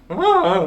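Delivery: imitating Steph's sudden cry in her sleep; sleep-talk cry. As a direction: blurt it out half asleep. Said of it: "Steph went".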